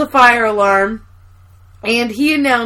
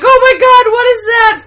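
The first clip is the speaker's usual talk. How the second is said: high, louder frightened cry; mock terror; 10 semitones higher, 5 dB louder than usual. Coworkers hearing firecrackers, high mock fright. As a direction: shriek it at the firecrackers. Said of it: "they're all like".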